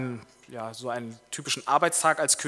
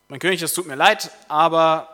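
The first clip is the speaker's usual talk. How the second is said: lightly voiced valet, higher and louder; insistent valet; 3 semitones higher, 10 dB louder than usual. Valet waking a reluctant king, apologetic but insistent. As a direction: apologise but hold your ground, loudly. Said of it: "der Kammerdiener sagt".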